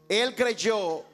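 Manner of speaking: ordinary talk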